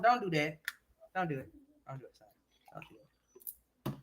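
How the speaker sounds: ordinary talk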